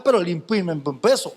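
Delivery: normal talking voice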